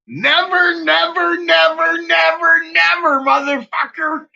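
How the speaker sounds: shouted never. Real, not acted. Streamer yelling his moon rule at viewers, hammering never. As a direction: yell each never like a rule.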